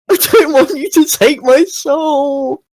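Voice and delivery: put-on high, slow, drawn-out childlike wail; hammed-up scared whine; frightened wail. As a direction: plead like a terrified little creature. Twig panics at soul taker.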